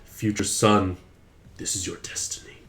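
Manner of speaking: low, hushed, portentous pronouncement; dramatic destiny voice. Pretend. Father voicing a prophecy to his future son, hushed and grave. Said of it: "with Alexander just floating around in pad saying".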